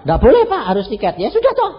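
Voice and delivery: loud, high insistence; retold heated refusal. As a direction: push back hard, voice up. Petugas dan dia bertengkar. (penolakan keras dengan suara tinggi)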